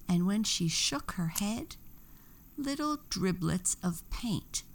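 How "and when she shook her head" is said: plain reading voice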